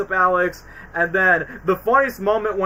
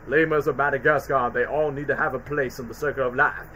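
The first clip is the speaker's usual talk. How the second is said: Morgan Freeman imitation; 5 semitones lower, 5 dB quieter than usual. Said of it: "Morgan Freeman".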